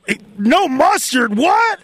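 imitating high, drawn-out disbelief at an order; slow it down, then jump high on "what"; disgusted. Cook looking at the orders, mock disgusted disbelief. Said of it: "like he was disgusted by the orders".

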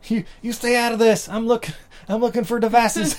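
high-pitched stammered brush-off; hammed-up defensive son; mock flustered son. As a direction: shoo Mom away in a put-on harried voice. Acted son fending off mom.

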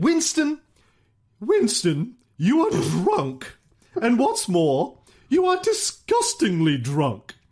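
high, affronted society lady; indignant woman's scolding. Acted. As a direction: scold him in haughty disgust. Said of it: "she allegedly said".

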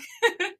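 bursting high giggle. Real, not acted. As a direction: let the giggle spill out. Laughing after singing.

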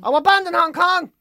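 high-pitched put-on indignation; mock outrage. Mock outrage at being banned.

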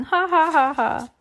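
high ha-ha, sliding down; fake laughter. A narrator's reading. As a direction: force out a pretend laugh, word by word. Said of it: "as if she were joking".

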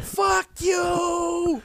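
long high drawn-out holler; jeering crowd. Acted. Crowd jeering the performer, drawn-out holler. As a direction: stretch it out high like a heckler.

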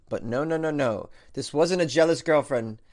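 ordinary talk, nothing marked

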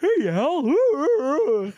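high, loud, goofy character call; Robin Williams impression. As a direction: badly imitate a manic cartoon genie. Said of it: "what a terrible impression of Robin Williams".

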